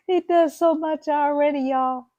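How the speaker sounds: high, loud burst of praise for hubby; excited gush. Real, not acted. Praising her husband to viewers, high loud gush. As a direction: gush about him, voice jumping up.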